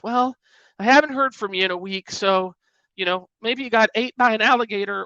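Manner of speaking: high, whiny mock of a needy texter; cringey mock voicemail. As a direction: make the passive-aggressive note sound pathetic. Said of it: "the cringe".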